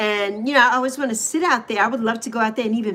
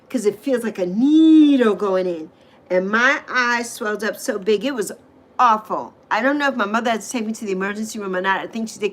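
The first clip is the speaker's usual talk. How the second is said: ordinary talk, nothing marked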